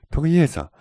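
high, loud joyful call; overjoyed shout of greeting; delighted exclamation. A narrator's reading. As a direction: cry out the name in delight. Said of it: "rief Misses Davian erfreut aus".

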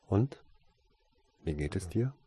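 mildly inquisitive, lifted opening; a touch of eager interest; curious. A narrator's reading. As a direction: ask with gentle curiosity. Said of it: "fragte sie neugierig".

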